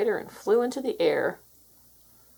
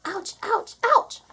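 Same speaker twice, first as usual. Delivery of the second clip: pained yelps; 13 semitones higher, slower than usual. Acted. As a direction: squeal at each peck. Cow being pecked by chickens.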